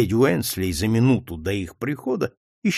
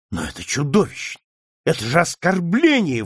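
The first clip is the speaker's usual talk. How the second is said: outburst; 5 semitones higher than usual. A narrator's reading. Ronald bursts out at the insult, indignant. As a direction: burst out in outrage. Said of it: "вырвалось у него".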